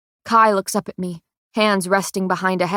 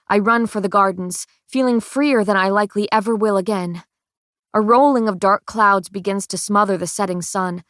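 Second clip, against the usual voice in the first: usual speaking voice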